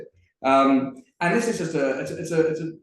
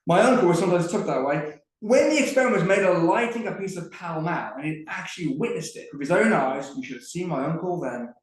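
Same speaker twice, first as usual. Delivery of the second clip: higher, lively Cockney lamplighter telling a tale; Tom the lamplighter; 5 semitones higher, 4 dB louder than usual. Acted. Performer reads Tom the Lamplighter to the audience, lively Cockney storytelling. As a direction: spin the yarn like a chatty Cockney geezer.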